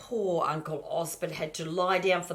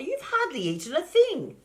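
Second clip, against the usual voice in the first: fussing mother; 13 semitones higher, 5 dB louder than usual. Acted. Mother voiced, worried.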